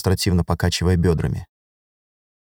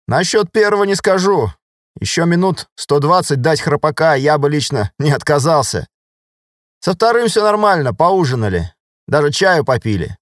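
high-pitched, hearty sergeant voice; easygoing soldier; 7 semitones higher, 3 dB louder than usual. Acted. Sergeant reports to lieutenant for the squad, good-humoured. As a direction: report back briskly, pitch lifted.

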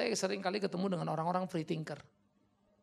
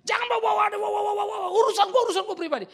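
high, loud, spluttering imitated rant; mock raging; 12 dB louder, faster than usual. Preacher mimics his friend blowing up, hammed-up rage. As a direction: imitate someone going berserk. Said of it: "ngamuk dia".

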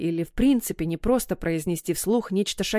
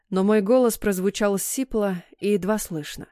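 usual speaking voice

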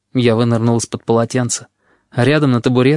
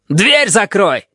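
high, explosive shouted order; sudden yell; 7 semitones higher, much slower than usual. A narrator's reading. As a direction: yell it out suddenly, pitch leaping up. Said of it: "вдруг заорал так громко". (внезапный громкий окрик)

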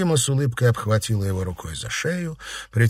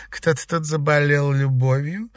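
slow, higher-pitched mock concern; condescending tease; 5 semitones higher than usual. A narrator's reading. Girl teasing her jealous rival about love, condescending. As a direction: condescend with a smile. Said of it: "снисходительно улыбнулась Сима".

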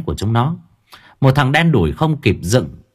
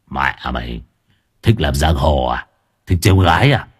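gangster voice; 8 semitones lower than usual. Acted. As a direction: speak like a street mob boss. Gangster voice again. (giọng xã hội đen trầm, đe dọa)

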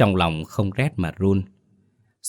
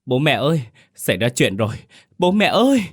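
screaming; 6 semitones higher than usual. Acted. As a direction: yell to wake mum and dad in the yard. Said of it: "tiếng Đào gào thét ngoài sân".

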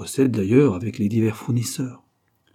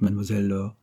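plain reading voice

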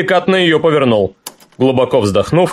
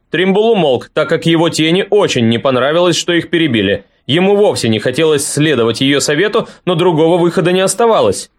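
usual speaking voice

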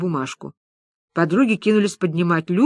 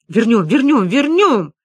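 louder, building insistence; vow-like; 3 semitones higher, 5 dB louder, slower than usual. Acted. Лиза promises to get the flat back, vowing. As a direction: climb in pitch on each repeat, swearing it. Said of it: "произнесла это как клятву".

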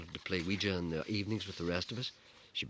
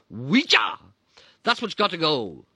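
high, loud furious yell; 10 semitones higher, 13 dB louder, slower than usual. Acted. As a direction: yell it at him. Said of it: "she yelled".

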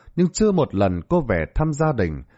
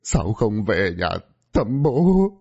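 wailing father; 3 semitones higher than usual. A narrator's reading. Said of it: "gào khóc".

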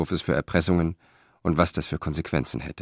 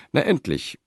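higher, impatient blunt greeting; direct, blunt; 8 semitones higher than usual. A narrator's reading. Praetor greets the waiting messenger, bluntly impatient. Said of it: "auf seine direkte Art".

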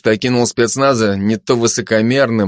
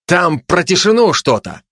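higher, mildly testy correction; a touch of anger, pitch raised; annoyed; 7 semitones higher than usual. Acted. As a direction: correct them with some impatience. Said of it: "рассердился фашист".

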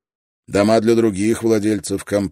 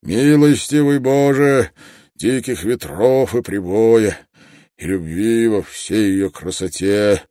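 slow, raised, solemn invocation; loud, drawn-out phrases, reverent and earnest; heartfelt prayer; 3 semitones higher, 6 dB louder than usual. A narrator's reading. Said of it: "Его голос звучал проникновенно".

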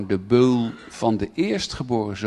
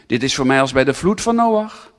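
ordinary delivery

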